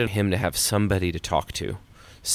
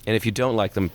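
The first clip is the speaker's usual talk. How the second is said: usual speaking voice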